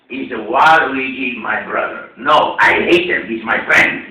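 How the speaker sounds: loud, slow, hammed-up character voice; in-character cartoon voice. Actor answering about his brother Waluigi, loud cartoon character. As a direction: draw it out loudly in character.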